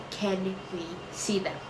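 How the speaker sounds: hushed, toned-down fan quote; understated fan imitation. Vlogger quoting everybody asking to see the characters, very softly. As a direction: imitate fans very softly, without the squeal. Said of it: "everybody's gonna be like".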